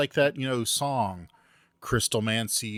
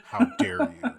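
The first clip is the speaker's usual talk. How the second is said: high hammed-up indignant cry; overplayed offended gasp; mock outrage; 14 semitones higher than usual.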